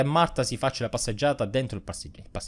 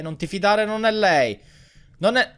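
alarmed; 8 semitones higher than usual. Real, not acted. Panicked warning in horror game.

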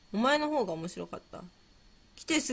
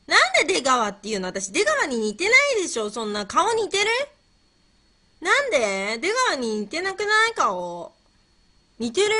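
loud, high-swooping objection; indignant protest; 7 semitones higher, 6 dB louder than usual. Real, not acted. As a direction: protest loudly in rising disbelief. Protests being likened to Degawa. (納得いかない大声の抗議)

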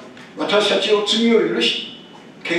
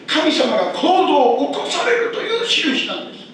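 higher, louder preaching build; fervent preaching; 7 semitones higher, 4 dB louder than usual. Acted. Pastor preaching to the congregation with full force.

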